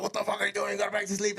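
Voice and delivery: high-pitched mock irritated complaint; pissed-off guy. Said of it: "all pissed off".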